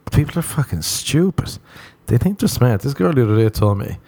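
ordinary talk

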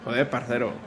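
mock Madrid lisp on parcero; playful Spain Spanish impression; Spanish accent. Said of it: "imitando es a su mamá".

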